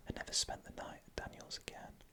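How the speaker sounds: usual speaking voice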